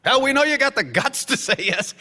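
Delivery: ordinary delivery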